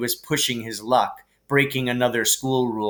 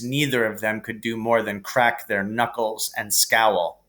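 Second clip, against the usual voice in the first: plain reading voice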